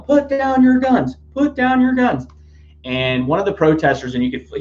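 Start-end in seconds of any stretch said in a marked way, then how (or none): 0.0-2.3 very high, slow, loud mock yell; imitated yelling. Protester yelling at police to drop weapons, high slow mock shout. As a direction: bellow it at the cops like the protester did. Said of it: "He was yelling".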